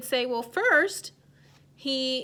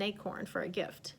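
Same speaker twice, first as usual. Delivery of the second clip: whisper; 4 semitones lower, 9 dB quieter than usual. Real, not acted. Teacher recaps story for kids.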